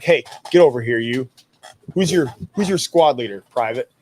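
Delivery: loud, sharp drill-sergeant call; barking sergeant. Retold or imitated. Sergeant calling over another squad's private, loud and barking. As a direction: bark it at a sloppy private.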